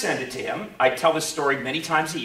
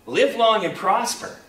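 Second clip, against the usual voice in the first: high, slow, drawn-out salute; cheery mock farewell; 6 semitones higher, 4 dB louder, slower than usual. Girl takes leave of Gary, imitated high farewell. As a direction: imitate her sing it out, pitched up.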